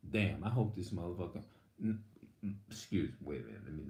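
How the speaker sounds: low, soft muttered thought; 속으로 중얼거리는 낮은 목소리; hushed inner thought. Retold or imitated. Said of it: "have y'all ever been in a situation like".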